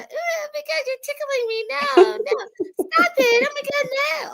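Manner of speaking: very high giggly squeal, being tickled. Retold or imitated. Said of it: "that voice that he does".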